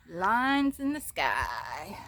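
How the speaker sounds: very slow, loud, stretched words; drawn-out exclamation. Real, not acted. Vlogger showing viewers the lines in the sky, drawn-out exclamation. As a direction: stretch it right out, loudly. Phrase repeated, drawn out for effect.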